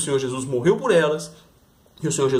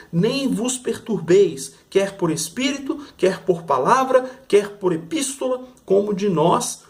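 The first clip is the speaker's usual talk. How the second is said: measured, solemn Bible reading, drawn out; scripture reading; 4 semitones higher than usual. Acted. Preacher reading Thessalonians to the church, solemn. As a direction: read it slowly like holy text.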